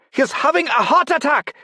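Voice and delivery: put-on very high, loud Chinese-accented cry; Chinese-accented, very high, loud shout; accented English shout. A narrator's reading. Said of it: "heavily accented English".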